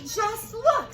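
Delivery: high, loud cry; excited cook. Acted. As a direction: call out eagerly like the cook. Cook's exclamation.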